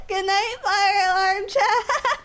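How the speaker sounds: high, drawn-out words dissolving into giggles; breaking into laughter. Real, not acted. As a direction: let the laugh take over the ending.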